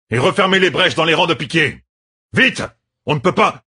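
high, urgent commands to the troops; shouted orders. A narrator's reading. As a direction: bark urgent orders over the battle. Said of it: "ordonna le général". (ordres criés, aigus et pressants)

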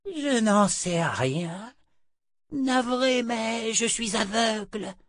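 put-on high, slow old-woman voice; blind old woman. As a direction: play a sorry old woman, high and drawn out. (vieille femme aveugle, voix aiguë et lente)

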